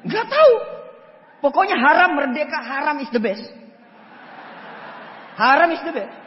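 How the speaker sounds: put-on loud, high childish insistence; loud stubborn claim; stubborn shout. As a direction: insist loudly without reason.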